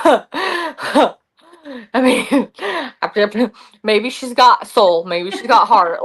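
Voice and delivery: giggling through the words; laughing modesty. Retold or imitated. Winner answering the amazed crowd, laughing bashfully. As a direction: shrug it off with a laugh. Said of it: "And I'm like".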